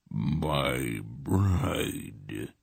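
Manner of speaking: low, soft rasping god voice; horrid rasp. A narrator's reading. Dark god greeting Polgara, low horrid rasp. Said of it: "he rasped in a horrid voice".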